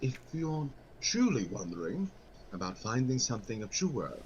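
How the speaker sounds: deep, dropped sly merchant voice; low voiced merchant. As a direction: drop the voice low and smooth. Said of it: "his voice completely just drops".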